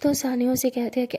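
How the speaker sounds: ordinary delivery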